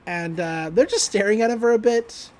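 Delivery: usual speaking voice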